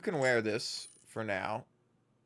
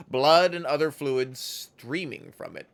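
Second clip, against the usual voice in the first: normal talking voice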